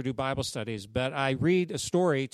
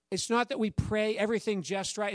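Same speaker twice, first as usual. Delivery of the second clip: usual speaking voice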